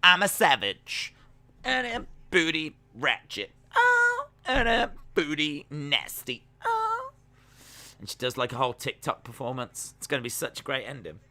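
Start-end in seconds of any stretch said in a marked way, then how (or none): 0.0-7.1 put-on sassy voice with very high squeals; mock wrestler singing her lines; Lana parody. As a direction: play it like a cheeky TikTok routine. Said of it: "and she's like".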